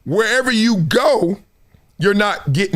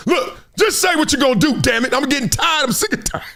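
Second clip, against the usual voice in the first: higher-pitched impatient demand; exasperated, raised-pitch imitation; mock fed-up outburst; 5 semitones higher than usual. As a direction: act out someone who has had enough. Imagined fed-up reporter snaps back.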